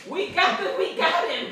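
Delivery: high, loud, laughing glee; excited triumph. Retold or imitated. Wife with the broom at the jumping bag, loud high glee. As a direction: crow it like you caught him. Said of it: "but I'm thinking".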